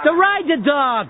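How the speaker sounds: slow, high mimicked Philly accent. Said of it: "hears me doing this accent".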